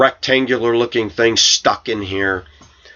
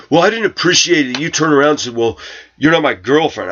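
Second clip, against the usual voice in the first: higher, mildly aggrieved comeback; retold protest in a raised pitch; imitated indignant retort; 6 semitones higher than usual. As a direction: mimic a coworker's complaint, pitched up. Acts out coworker's indignant reply.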